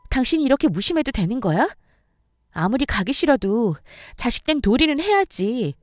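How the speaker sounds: hurt, indignant retort; firing back angrily. Acted. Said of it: "남편에게 쏘아붙였습니다".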